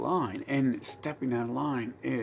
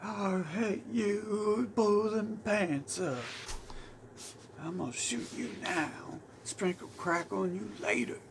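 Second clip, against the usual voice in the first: mock rage; 9 semitones higher than usual. Mocking an enraged bigot, a high hammed-up rant. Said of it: "they might go rage".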